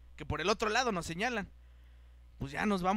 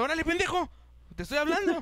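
louder, higher mock telling-off; mock scolding; 8 semitones higher, 3 dB louder than usual. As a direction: act out an impatient officer scolding. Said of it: "como diciendo". (regaño fingido, impaciente)

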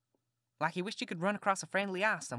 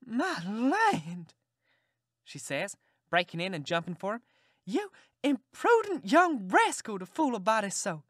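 high, loud overjoyed scold; scolding with joy as she runs to hug him; delighted aunt; 5 semitones higher, 3 dB louder, slower than usual. Acted. Aunt recognizing her nephew, high and overjoyed.

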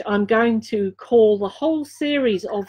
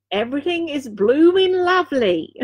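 broad Dorset accent, pitched higher; proud playful Dorset accent; 5 semitones higher than usual. Shown as a demonstration. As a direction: lean into a West Country Dorset burr on blooming lovely. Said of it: "if I say it in my Dorset accent".

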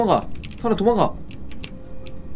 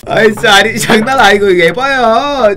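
loud disbelief; 4 semitones higher, 6 dB louder than usual. Real, not acted. Just died, indignant outburst.

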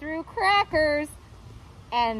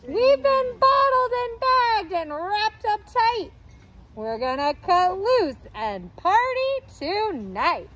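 party excitement; 7 semitones higher than usual. A narrator's reading. Food character voiced in story.

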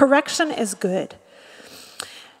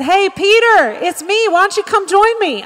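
high, loud mock-friendly hail; calling out; 8 semitones higher, 6 dB louder than usual. Preacher imagining Jesus hailing Peter on the water, calling out. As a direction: call across to a friend, pitched up.

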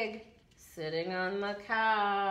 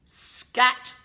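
cow character; 3 semitones higher, 10 dB louder than usual. A narrator's reading. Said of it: "said the cow".

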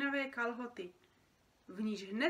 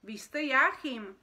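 slow, loud, higher, awed naming; amazed recognition; 4 semitones higher, 10 dB louder, slower than usual. Acted. Creature naming the famous dreamer, amazed. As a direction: draw out the name in disbelief.

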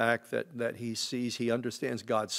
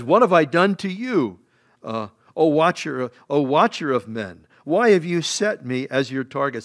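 louder, raised pleading to God; pleading accusation; 3 semitones higher, 8 dB louder than usual. Retold or imitated. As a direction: press God with the question. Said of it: "And he says".

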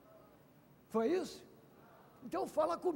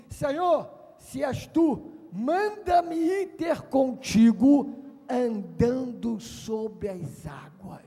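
solemn request; 2 semitones lower, 6 dB louder, much slower than usual. Acted. Peter declaring his path to Jesus, slow and solemn. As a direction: proclaim each word deliberately. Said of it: "Pedro falou assim".

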